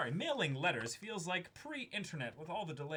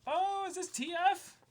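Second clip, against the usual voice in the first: surprised; 6 dB louder than usual. Real, not acted. Recognizing a friend's letter.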